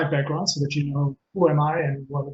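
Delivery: usual speaking voice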